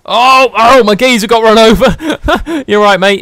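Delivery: loud, high, laughing cry; laughing alarm. Real, not acted. Player laughing as his buddy gets run over, loud and high. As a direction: yelp then laugh it off.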